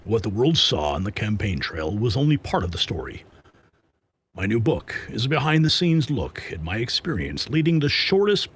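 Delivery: low, dramatic trailer narrator voice; a light movie trailer voice. Pretend. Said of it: "like, trailer voice".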